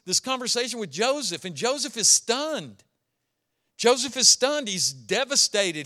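ordinary delivery

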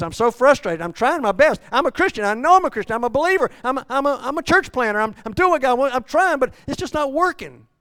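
very loud, high, pleading cry; desperate Paul. Acted. Preacher voicing Paul's despair at full force. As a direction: shout the frustration from the pulpit. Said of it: "Paul says".

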